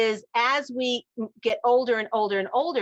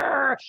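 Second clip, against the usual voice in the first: annoyed groan; 4 semitones higher, 4 dB louder than usual. Retold or imitated. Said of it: "I'm like".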